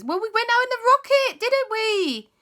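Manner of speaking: very high, quick and chirpy; high Auntie Lisa voice. Acted. Aunt excusing the rocket trip, very high. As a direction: chirp it out breezily. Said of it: "And Auntie says".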